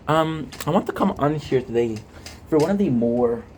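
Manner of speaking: ordinary talk, nothing marked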